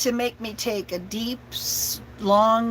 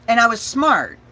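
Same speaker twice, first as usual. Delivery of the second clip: normal talking voice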